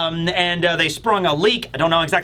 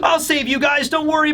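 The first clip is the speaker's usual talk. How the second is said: put-on high-pitched, overconfident boy voice; mock hero; 7 semitones higher than usual. Colin reassuring the other children, mock heroic bravado. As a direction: promise rescue with hammed-up bravado. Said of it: "Colin has a face fault moment".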